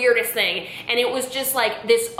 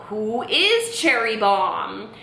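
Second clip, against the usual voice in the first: "is" raised and stretched in "who is"; slow, baffled retort stressing "is"; puzzled echo; 2 semitones higher, much slower than usual. Retold or imitated. Daughter who has no idea answers mom, puzzled stress on "is". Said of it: "And I was like".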